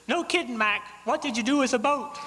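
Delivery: a parrot teasing the man clinging to wood. Acted. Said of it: "He says".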